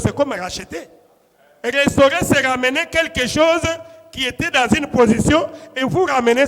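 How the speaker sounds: loud, driving pulpit teaching; forceful preaching. Real, not acted. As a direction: build to an emphatic, high-pitched climax.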